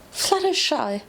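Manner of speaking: high anguished scream of a name. A narrator's reading. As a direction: cry her name out in horror, pitched high. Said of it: "I screamed".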